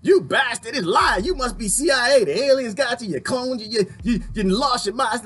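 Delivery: a hammed-up angry online mob; mocking angry critics. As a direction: ham up the angry commenters. Mocking critics who attack him.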